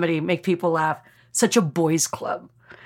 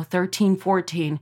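ordinary delivery

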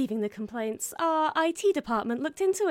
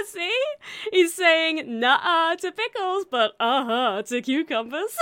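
laughing; 5 semitones higher, 7 dB louder, slower than usual. Acted. Explaining meme, laughing.

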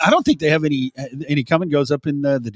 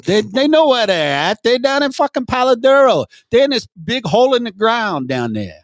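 mock Chicago accent; 7 semitones higher than usual. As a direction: ham up a Chicago guy giving directions. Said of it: "Why are you talking like you're from Chicago".

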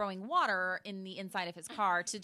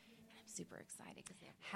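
fast breathy whisper; 18 dB quieter, faster than usual. Real, not acted. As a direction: whisper it quickly. Whispering at restaurant.

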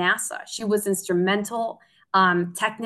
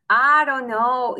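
slow, drawn-out, higher hesitant voice; imitated doubt; 5 semitones higher, 4 dB louder, slower than usual. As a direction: act out a doubtful parent, stretching the words. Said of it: "so confident and so calm".

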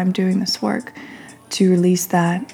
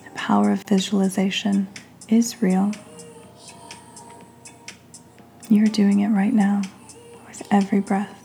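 guided meditation. Real, not acted. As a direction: lead them gently through each breath, leaving space between phrases.

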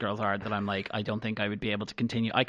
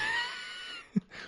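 high squealing laugh; 8 dB quieter than usual. Real, not acted. Laughing at joke.

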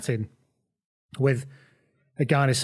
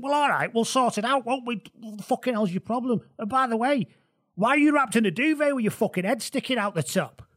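much higher, exasperated Manc voice; 10 semitones higher than usual. Retold or imitated. [name] challenges [name], high exasperated Manc swagger. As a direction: swagger and challenge him in Mancunian. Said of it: "essentially become [name] in your retelling".

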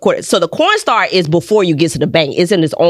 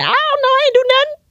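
very high, mock-innocent whine; squeaky I-didn't-do-it voice. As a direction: plead innocence like a kid caught. Playing innocent to principal.

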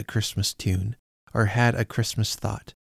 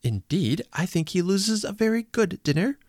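put-on high, quick young woman cutting in; interrupting niece; 9 semitones higher than usual. Niece interrupting Fred about dinner, high quick voice. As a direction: cut in, pert and lively. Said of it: "interrupted Scrooge's niece".

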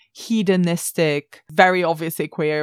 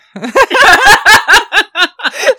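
burst of laughter; 14 dB louder than usual. Real, not acted. Laughing fit over the segue.

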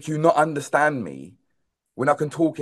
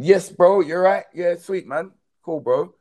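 casual English mate, pitched up; casual English accent; 6 semitones higher, 4 dB louder than usual. Acted. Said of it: "To Englishman".

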